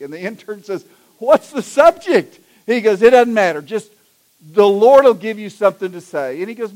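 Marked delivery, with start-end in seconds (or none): 1.2-2.4 high, loud, drawn-out startled question; acted-out startled yelp of a question; alarmed intern. Intern suddenly told to speak. 3.0-6.4 loud, impatient brush-off; brusque bishop. Acted. Bishop brushes off intern's question.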